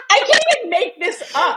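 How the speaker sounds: said high through laughter; high giggling disbelief; laughing. Real, not acted. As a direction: still laughing, get the words out. Laughing at own story.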